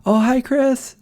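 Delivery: imitating someone calling out a greeting; retold friendly greeting. Stranger spots the streamer walking away, high called-out hello. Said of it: "somebody's like".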